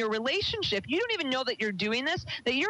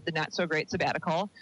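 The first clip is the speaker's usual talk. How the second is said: usual speaking voice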